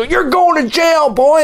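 high caricature cop voice; bullying cop. Acted. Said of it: "They go".

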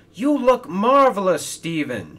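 loud, high-pitched admiring call; excited shout. Acted. Hero greets the freshly repainted Stephen, shouting in delight. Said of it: "shouted Hero".

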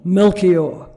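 loud, abrupt voice of another personality; deep, different voice. Retold or imitated. Hypnotized man suddenly naming himself, deep different voice. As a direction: announce the name in a forceful, different voice. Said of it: "came out immediately in this deep, different voice".

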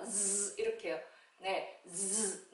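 "즈" as a vibrating voiced zzz; buzzing Z sound. Shown as a demonstration. Teacher showing the tongue position, with a held buzzing z. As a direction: buzz the tongue tip on "즈". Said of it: "혀끝을 달달 떨면서".

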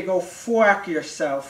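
"fuck" drawn out and distorted; slow mangled "fuck yourself"; put-on family voice. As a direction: stretch "fuck" into a silly "fwack". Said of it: "the family say".

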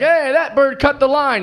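mock-point at the bird, slowly; teasing joke. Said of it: "I was like".

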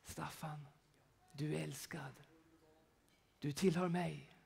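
soft, breathy whisper of God's voice; divine whisper. Pretend. Pastor voicing God's whisper to himself, softly whispered. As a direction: whisper tenderly, close and intimate. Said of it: "så börjar det viska här inne".

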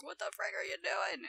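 mock mother barging in, pitched high; imagined alarmed mother. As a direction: play a mum demanding an explanation, high-pitched. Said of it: "I'm gonna alert my mother".

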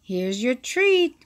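plain reading voice

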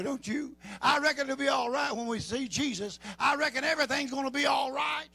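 loud, high, fervent preaching shout; preaching at full force. Acted. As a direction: fire up the congregation at full volume.

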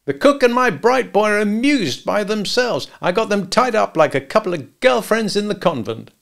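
lightly voiced cocky hitman; smug gangster. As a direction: crack a crude joke to your partner. Al jokes from kitchen.

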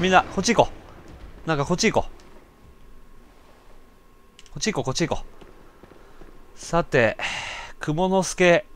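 normal talking voice

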